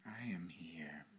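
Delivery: soft whispered affirmation. Shown as a demonstration. Pastor whispering the affirmation for the congregation to repeat. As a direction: breathe the words out, no voice. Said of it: "would you say this with me".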